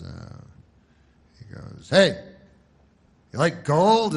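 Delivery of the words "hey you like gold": loud, raised gold-ad pitch; commercial pitchman. Retold or imitated. Guy in a commercial pitching gold to viewers, loud hard sell. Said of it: "have a guy in the commercial and he goes".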